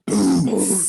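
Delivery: Boots with an explosive B like a kick drum; explosive bass drum. Shown as a demonstration. Coach demos the beatbox bass drum, explosive Boots. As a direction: pop the B in Boots hard. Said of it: "deep breath in and say it together".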